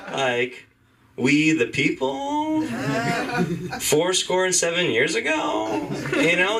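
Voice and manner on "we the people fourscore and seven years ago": slow, high rising uptalk on each phrase; famous words ending on an up. Shown as a demonstration. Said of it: "Can you imagine our forefathers talking like this?".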